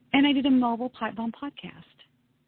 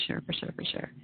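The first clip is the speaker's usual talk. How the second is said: whispered echo; 11 dB quieter than usual. Real, not acted. Echoing the guest's compliment.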